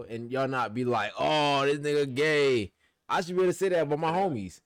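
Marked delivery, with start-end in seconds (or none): 1.2-2.7 mocking judgmental voice. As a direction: sneer it like a judgy friend. Mocking what people say.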